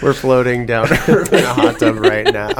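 laughing. Real, not acted. Joking about recording in hot tub.

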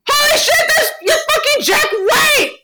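very high, squealing fangirl shout; laughing, high-pitched retold exclamation; starstruck shout. Retells starstruck reaction to the star.

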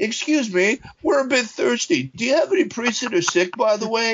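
high-pitched, polite little request; mock-polite band members. Imagined metal band asking at a rectory door, high mock-polite voice. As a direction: pitch it up like a timid caller. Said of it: "it's like these guys".

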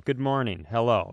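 usual speaking voice

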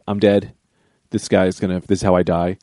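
low, soft, retold doom; resigned inner voice. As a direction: drop down low and quiet, accepting the end. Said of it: "I'm like".

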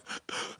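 sharp sobbing gasp for air; imitating his own ugly crying gasp. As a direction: gasp like a crying man fighting for breath. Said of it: "It was like".

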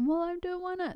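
put-on kid whine. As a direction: whine like a kid who won't go. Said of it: "I'm like".

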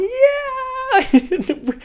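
high, loud stoked whoop; whooping yell. Retold or imitated. Kelly whooping at the first wave, loud. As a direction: let out a giant stoked yell.

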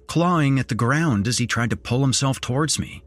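plain reading voice

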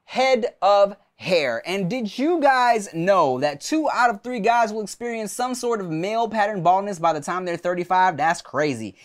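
upbeat, punchy sponsor read. Acted. Host reading a hair loss ad to listeners, upbeat. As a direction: sell it like a hype host.

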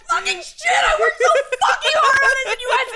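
high, laughing, fake-crying rant; mock meltdown. Retelling the Hacks comic's onstage breakdown, laughing fake sobs. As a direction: ham up a comic crying on stage. Acting out comedian's tearful meltdown.

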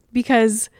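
ordinary delivery

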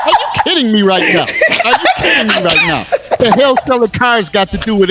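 high, laughing disbelief; incredulous. Real, not acted.

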